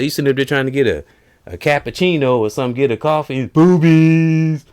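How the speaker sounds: fast, high, loud put-on rant; mock rant. Comic ranting at a breastfeeding woman, fast high blurt. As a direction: rush it then blurt the last word. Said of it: "He said".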